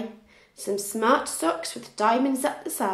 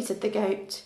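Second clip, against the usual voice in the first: usual speaking voice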